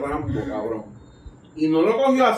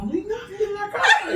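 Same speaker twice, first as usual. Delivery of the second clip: mock woman. Quoting the woman after search.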